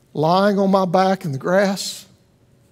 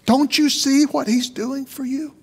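higher, pleading rising question; lightly voiced sky, imploring; mock shout; 6 semitones higher than usual. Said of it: "It was the sky shouting".

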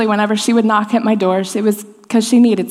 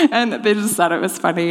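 usual speaking voice

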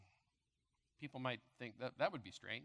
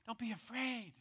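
gentle reassurance; 7 semitones higher than usual. Acted. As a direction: comfort them gently. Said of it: "this is my word to you".

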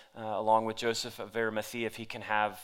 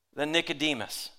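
usual speaking voice

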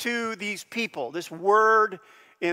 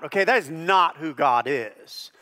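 ordinary delivery

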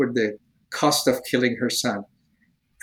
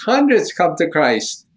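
ordinary delivery

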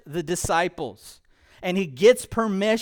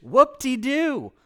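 sarcastic whoop-de-doo; 4 semitones higher, slower than usual. Acted. Sarcastic reply to churchgoer.

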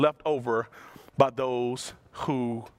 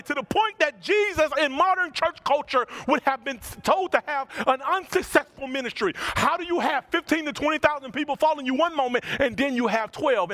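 preaching at full force; 9 semitones higher than usual. Acted. Pastor preaching to Overlake, fervent and raised. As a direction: drive the point home to the congregation.

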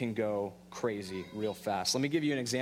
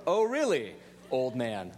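sarcastic retort; slower than usual. Retold or imitated. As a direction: throw a cheeky challenge at the old man. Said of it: "I didn't say that".

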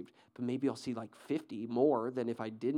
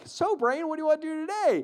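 much higher squeaky cartoon mouse voice; Pinky from Pinky and the Brain; 14 semitones higher, 4 dB louder than usual. Preacher imitating Pinky asking Brain, high squeaky mouse voice. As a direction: pipe it up like a goofy little cartoon mouse. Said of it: "he's just like".

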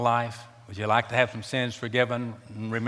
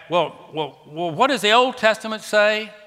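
skeptic's question; 10 semitones higher, 5 dB louder than usual. Retold or imitated. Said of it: "You say".

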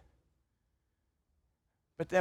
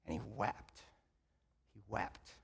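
very soft, low, grieving; sorrowful hush; 9 semitones lower, 16 dB quieter than usual. A narrator's reading. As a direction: let the words almost vanish. Peter weeps, sermon climax.

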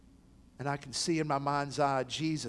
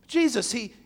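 ordinary delivery

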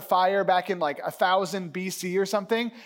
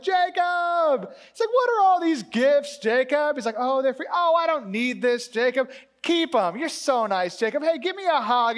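raised, delighted acted welcome; effusive brotherly greeting; 6 semitones higher, 4 dB louder than usual. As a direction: gush at a long-missed brother. Esau warmly greeting his brother.

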